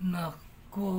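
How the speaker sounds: normal talking voice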